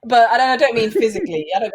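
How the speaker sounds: words laughed out loud; laughing. Real, not acted. Host laughing off his violent remark, loud. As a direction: laugh through the correction.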